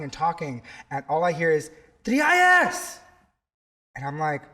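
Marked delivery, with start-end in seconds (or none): 2.0-3.0 loud high yell from across the street; calling out. Retold or imitated. Stranger spots him in Times Square, loud high call. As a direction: call his name out across the crowd. Stranger yelling his name.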